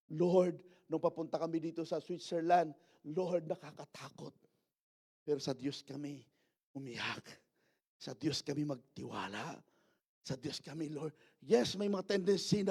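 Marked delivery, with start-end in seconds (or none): 0.1-11.1 soft, low pleading prayer; tearful prayer retold. Pastor retelling a fearful prayer to the Lord, hushed pleading. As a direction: pray it quietly, voice dropped right down. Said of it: "iniiyak din ng, namin kay Lord".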